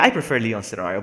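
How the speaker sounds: normal talking voice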